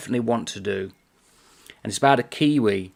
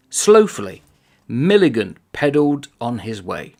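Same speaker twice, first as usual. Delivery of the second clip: lightly performed book reading, a little louder; reading aloud from a novel; 3 semitones higher, 5 dB louder than usual. Acted. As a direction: savour the comic prose as you read it out. Reads a passage from the novel.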